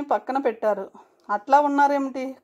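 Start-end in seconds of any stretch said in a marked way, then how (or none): none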